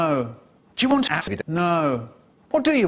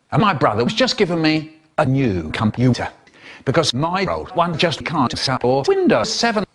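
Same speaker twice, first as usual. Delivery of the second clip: normal talking voice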